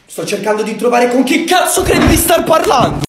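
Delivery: loud furious snap; angry shout. Acted. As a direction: yell it right back at him. Son snaps at father. (urlo arrabbiato)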